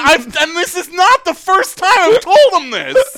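high, loud, indignant complaint; exasperated outburst. Real, not acted. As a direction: raise your voice in fed-up protest. Exasperated rant about careless roommate.

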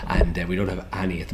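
ordinary talk, nothing marked

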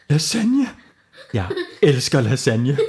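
thrilled disbelief turning to glee; delighted. Acted. Knud hears he can win lasagne, delighted laugh.

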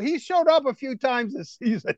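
normal talking voice

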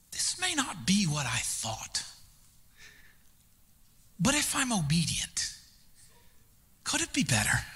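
hushed hopeful prayer. Retold or imitated. Trusting God, inner prayer voiced.

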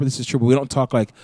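ordinary talk, nothing marked

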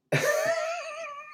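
a sudden high-pitched squeal of a laugh; high squeaky giggle. Real, not acted. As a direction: burst into a squeaky falsetto giggle.

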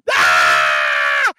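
loud screaming cackle; wild shriek breaking into laughter; maniacal scream-laugh. Retold or imitated. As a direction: burst out in a crazed, screaming laugh. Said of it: "laugh maniacally like a crazy person".

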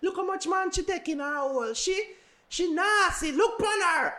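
high mocking gossip voice; gossiping girl voice. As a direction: squeal it like a catty girl. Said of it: "wanna no ram for chat the girl them to say".

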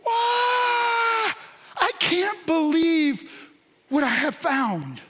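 opening the treasure box in amazement; awed wonder. Pretend. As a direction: marvel at the treasure you just opened. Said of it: "you went".

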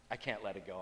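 ordinary talk, nothing marked

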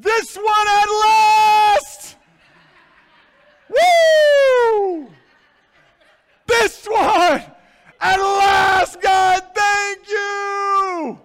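high, loud, slow shouts of joy with a whoop; ecstatic shout. Shown as a demonstration. Pastor voicing Adam thrilled to see Eve, ecstatic shouting. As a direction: cry it out like a man overjoyed at last. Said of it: "it should be read probably in this way".

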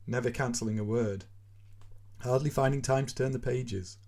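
plain reading voice

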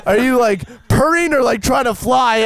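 high incredulous shouting; excited high shout. Retold or imitated. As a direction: yell at the cat in disbelief. Shouting at cat, laughing.

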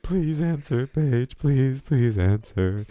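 high, loud, slow whiny begging; mock drunk pleading. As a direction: beg the phone to pick up. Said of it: "I'm like".

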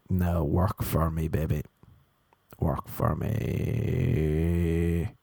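low, slow mock incantation; will it to work, stretching the last word; smug coaxing. Comedian sitting back commanding his vision board, smug coaxing.

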